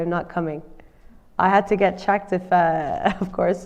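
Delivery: normal talking voice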